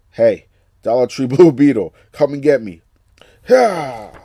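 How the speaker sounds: loud, cocky taunt; yelling a cheap insult at the enemy; mocking taunt. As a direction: shout a jeer at the villain. Taunting game boss, voicing Jaime.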